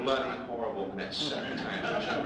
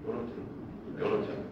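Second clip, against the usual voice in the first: mantra. Retold or imitated. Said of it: "doing a mantra, like a Buddhist monk".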